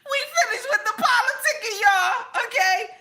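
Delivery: high laughing squeal; finished with politics, laughing high. Real, not acted. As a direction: laugh it out, voice cracking up. Laugh heard, high far voice.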